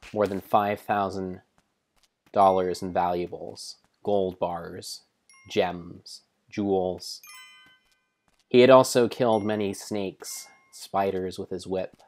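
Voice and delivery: usual speaking voice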